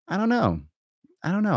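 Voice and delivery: high squeaky i-don't-know; mock falsetto. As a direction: toss it off in a silly squeak. Mocking high sing-song.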